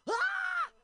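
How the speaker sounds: high, loud mock scream; short burst of pretend alarm. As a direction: scream it for the bit. Mock reaction to a catcaller.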